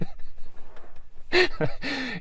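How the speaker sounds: soft airy laugh; breathy laugh. Real, not acted. Laughing at own joke.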